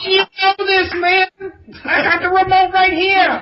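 high, loud comic squeal; Chris Tucker voice. Pretend. Bragging to a friend about the remote, high Chris Tucker squeal. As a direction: crow it like Chris Tucker showing off. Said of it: "answer him in the Chris Tucker voice".

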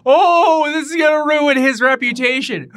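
high, loud mock alarm; mock horror. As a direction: shout it like a tabloid scandal. Mock scandal shouting.

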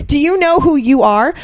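high, loud, squeaky gasp of awe; starstruck disbelief. Retold or imitated. Young fan meeting her hero, stunned and high. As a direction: blurt it out like a starstruck kid. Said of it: "twenty-year-old me was just like".

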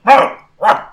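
two loud, high imitated barks; Pokemon barks. Game master voices Arcanine, barking. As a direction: bark like an excited creature. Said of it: "Arcanine barks".

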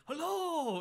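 high, loud German Hello; booming German camp counselor greeting; authoritarian German. Retold or imitated. Counselor greets the kids in a German voice. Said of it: "Very authoritarian German kind of voice".

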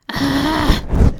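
high exasperated groan; frustrated groan. Acted. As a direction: let out a pent-up groan. Alone, frustrated after the quarrel.

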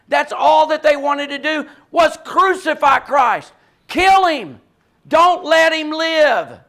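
loud, high and forceful, slowing at the end; loud preaching. Real, not acted. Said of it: "Sorry, I know I'm loud".

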